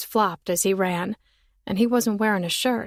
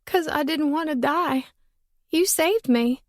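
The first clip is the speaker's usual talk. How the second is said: a lightly voiced girl, higher; tearful thanks to the boy; relieved little girl; 7 semitones higher than usual. Girl thanking the boy who saved her, higher voice.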